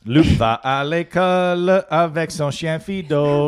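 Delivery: high, slow schoolbook recital; French class recitation. Retold or imitated.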